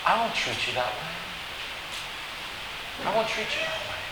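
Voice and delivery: hushed, gentle promise; soft reassurance. Acted. Joseph reassuring his frightened brothers, very softly. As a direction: drop right down and reassure them.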